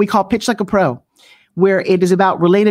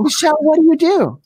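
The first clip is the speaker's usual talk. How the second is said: playful networking question; 7 semitones higher than usual. Acted.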